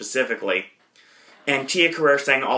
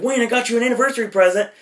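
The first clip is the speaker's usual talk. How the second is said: put-on higher, fast, eager girlfriend voice; imitating an excited girlfriend; 10 semitones higher, faster than usual. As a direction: gush it out quickly like a thrilled girlfriend. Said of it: "Wayne's like".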